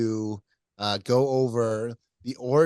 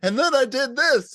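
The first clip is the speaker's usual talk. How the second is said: imitating comedian; 13 semitones higher, 7 dB louder than usual. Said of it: "and it's like".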